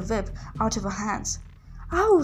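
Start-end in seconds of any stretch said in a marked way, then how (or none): 1.9-2.2 high, loud cry of pain; sharp raised "ow"; pained yell. A narrator's reading. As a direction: yelp out as if burnt. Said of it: "she yelled".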